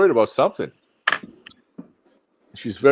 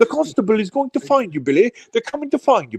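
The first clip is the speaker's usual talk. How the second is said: high-pitched put-on caller taunting Billy; hammed-up high-pitched tattling; mock streamer impression; 7 semitones higher than usual. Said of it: "he's probably yelling right now on his stream".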